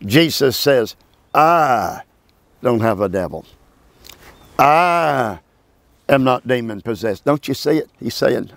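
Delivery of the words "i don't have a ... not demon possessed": I set apart, held, then dropping low; emphatic I. Shown as a demonstration. Preacher stressing Jesus' "I" to his class. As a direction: lean hard on the word I before each denial. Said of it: "it's an emphatic "I".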